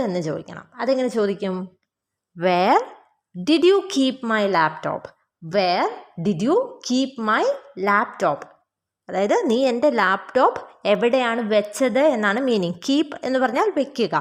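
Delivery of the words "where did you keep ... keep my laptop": slow, measured question for learners; slow model sentence. Shown as a demonstration. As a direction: say it slowly, phrase by phrase. Teacher models English question slowly.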